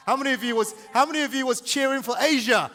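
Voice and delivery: high-energy shout to the audience; rousing the crowd. Real, not acted. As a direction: call out to get them cheering.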